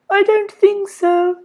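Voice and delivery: high, slow sing-song lady; chirruping. A narrator's reading. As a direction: chirrup it sweetly. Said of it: "chirruped Missus Lovely".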